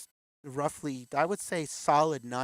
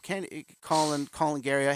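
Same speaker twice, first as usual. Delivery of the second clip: usual speaking voice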